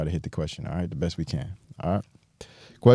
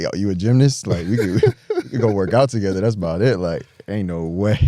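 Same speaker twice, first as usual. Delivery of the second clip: excited joking; 3 semitones higher, 6 dB louder than usual. Retold or imitated. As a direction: hype her up, half laughing. Retold joking reply to gymnast girl.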